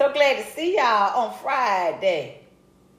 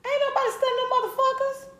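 high mocking dismissal; faster than usual. Real, not acted. Dismissing the trolls.

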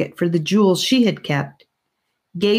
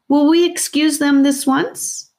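playful aunt; 10 semitones higher than usual. A narrator's reading.

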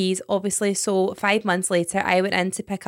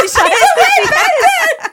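much higher, loud, slow through laughter; laughing; 10 dB louder, slower than usual. Real, not acted. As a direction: squeal it out while cracking up. Cracking up at the story.

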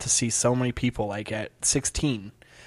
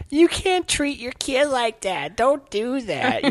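high, slow preachy busybody voice; mock a nosy stranger scolding a parent; 12 semitones higher, slower than usual.

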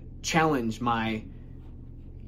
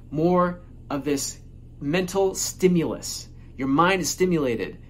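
ordinary talk, nothing marked

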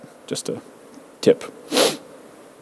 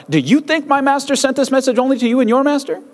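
much higher, brash taunt; arrogant chief of staff; 7 semitones higher than usual. Retold or imitated. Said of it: "chief of staff replied".

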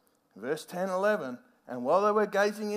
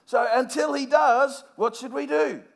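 usual speaking voice

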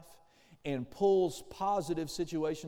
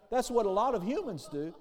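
normal talking voice

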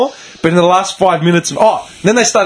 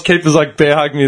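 usual speaking voice

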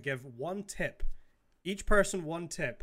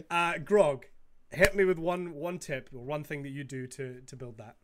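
ordinary talk

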